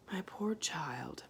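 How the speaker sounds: slow, soft haggard whisper. A narrator's reading. Homily whispering to her child, haggard and soft. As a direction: breathe it wearily.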